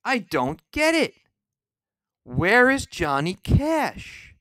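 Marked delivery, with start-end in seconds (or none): none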